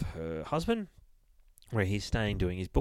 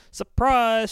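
high, loud surprise greeting; shouted surprise; 13 semitones higher, 9 dB louder than usual. Retold or imitated. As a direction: sing out a big, high surprise. Acting out surprise visit at door.

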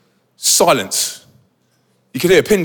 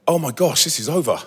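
quiet resignation; 2 semitones lower, 6 dB quieter than usual. Retold or imitated. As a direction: drop to a soft, defeated murmur. Resigned aside amid the crash.

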